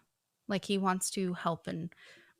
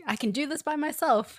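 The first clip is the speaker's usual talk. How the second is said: mock independent woman; 7 semitones higher, 3 dB louder than usual. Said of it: "trying to be womanly".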